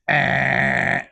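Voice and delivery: loud, held wrong-answer buzzer noise; buzzer imitation. Answering whether that worked, buzzer imitation. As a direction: buzz it like a failed quiz answer.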